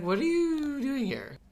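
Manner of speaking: high startled question; startled character. Retold or imitated. Waking up to find visitors, a high startled question. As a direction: jolt awake and demand why they're there. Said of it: "she's like".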